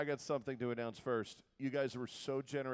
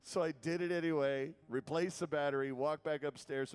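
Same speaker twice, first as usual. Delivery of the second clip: ordinary talk, nothing marked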